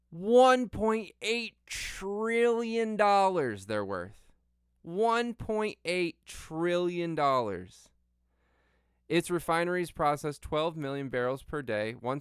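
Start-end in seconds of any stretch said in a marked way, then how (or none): 0.1-3.7 high, loud and drawn out word by word; slow, incredulous emphasis. Real, not acted. Stretches out the huge figure. 4.8-7.9 drawn out, higher, every word stressed; slow, incredulous emphasis. Real, not acted. Repeats the figure, drawn out.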